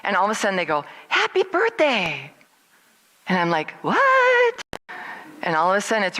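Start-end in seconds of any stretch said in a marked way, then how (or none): 1.1-2.3 high, drawn-out celebratory call; spring a surprise with a slow, high cheer; birthday surprise cheer. Retold or imitated. Son and girlfriend spring the surprise. 3.8-4.6 very high, loud squeal of shock; stunned disbelief. Acted. Stunned by the birthday surprise.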